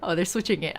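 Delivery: ordinary delivery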